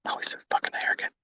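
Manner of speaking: fast mocking whisper; whispered aside. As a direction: hiss it like a heckler behind him. Voicing imagined critic aside.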